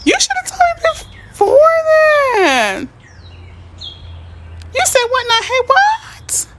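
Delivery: high-pitched, thrilled squeal of disbelief; excited squealing. Real, not acted. Host hears a follower is closing, excited squeal. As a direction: squeal it high, stretching the big words.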